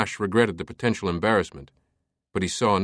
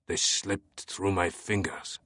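put-on soft, slow French-accented murmur; quiet self-reproach; 7 dB quieter, slower than usual. A narrator's reading. Captain alone in the alcove after losing fugitives, brooding. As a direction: brood softly to yourself. Captain talks to himself, frustrated.

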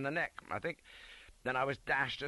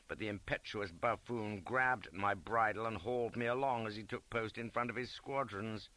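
ordinary delivery, nothing marked

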